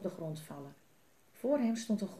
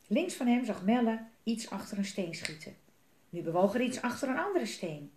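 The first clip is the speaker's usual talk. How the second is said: ordinary delivery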